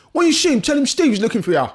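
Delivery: high, loud geezer instruction; cockney geezer. Retold or imitated. Retelling the geezer's message for Gary, loud cockney. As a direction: deliver it like a menacing street bloke. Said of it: "what he said next".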